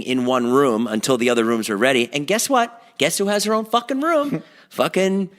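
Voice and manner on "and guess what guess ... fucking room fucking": high, laughing indignation; mock outrage. Real, not acted. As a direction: rise up in joking disbelief. Mock outrage at Natalie's room.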